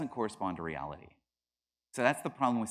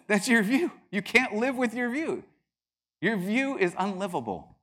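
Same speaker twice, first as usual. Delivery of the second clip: loud, high, emphatic argument; heated rebuttal; 11 semitones higher, 7 dB louder than usual. Retold or imitated. Debating his friend on pantheism, loud and insistent. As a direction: hammer it home at a high pitch.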